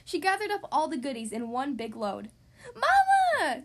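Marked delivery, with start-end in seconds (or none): 2.7-3.6 high, loud alarmed cry. Acted. Sister Bear crying out to Mama, loud and high. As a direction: shriek her name in alarm. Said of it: "cried Sister Bear".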